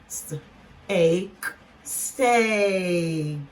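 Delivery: "St", long "a", "k", then stretched "steak"; sounded out. Shown as a demonstration. Teacher blending a cluster word for learners, sounded out. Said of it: "So we go st".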